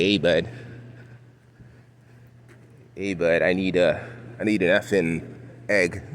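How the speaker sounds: lightly mocked Toronto bud accent; a hint of put-on "hey bud" accent. Imagined shopper asking for groceries, light mock Toronto accent. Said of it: "They don't talk like that".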